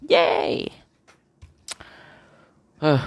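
usual speaking voice

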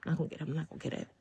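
a doubtful mumble that fades away; fading self-doubt. Retold or imitated. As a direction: let the doubt drain the voice down to almost nothing. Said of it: "I'm just doubting myself".

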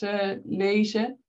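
normal talking voice

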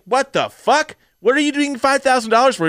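high, indignant mock demand; incredulous outrage. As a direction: challenge a spouse in disbelief. Acts out outraged reply to wife.

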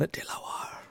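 whispered, hushed and secretive; mock whisper. Joking secret instructions to friend, whispered. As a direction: murmur it like a shady deal.

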